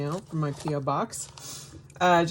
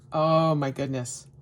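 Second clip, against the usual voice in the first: ordinary delivery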